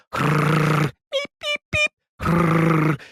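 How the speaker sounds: buzzing and high-pitched beeps; imitated buzzer beeps. Comic imitating buzzers on tables as show starts, buzzer noises. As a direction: mimic a restaurant table buzzer going off.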